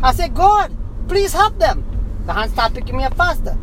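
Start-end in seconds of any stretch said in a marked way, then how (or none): 0.3-1.8 high, slow cry for help; retold scream. Man recounts pleading for others, retold scream. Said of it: "so I scream".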